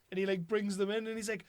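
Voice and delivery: ordinary talk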